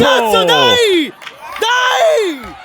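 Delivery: high yelled cheer, drawn out. Real, not acted. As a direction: yell the cheer at the goal. Teammate cheering the goal.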